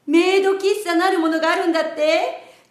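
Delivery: usual speaking voice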